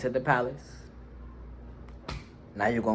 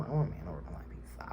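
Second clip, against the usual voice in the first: breathy whispered aside; 5 semitones lower, 9 dB quieter than usual. Real, not acted. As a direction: slip it in under your breath.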